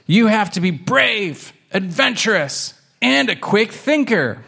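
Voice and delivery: high, punchy list in a kitten voice; high-pitched, earnest proclamation; emphatic kitten. Acted. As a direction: declare each quality like an eager kid. Kitten lists space traveler traits.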